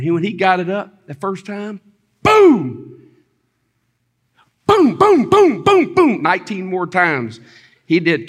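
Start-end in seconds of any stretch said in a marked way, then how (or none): 2.2-3.0 loud, high boom that falls away; big high-pitched imitated blast; booming lift effect. Acting out the first lift. 4.7-6.2 loud, very high repeated booms; string of high, explosive imitated booms; rapid-fire booms. Acting out repeated lifts.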